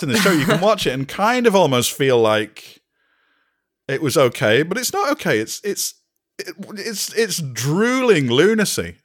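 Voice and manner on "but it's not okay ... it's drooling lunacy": exasperated. Real, not acted. Exasperated rant about plot.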